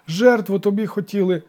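high, loud pleading; lamenting village elder; wailing. A narrator's reading. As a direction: wail it as a desperate excuse. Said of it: "заголосив староста".

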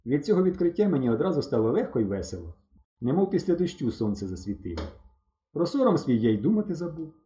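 ordinary delivery

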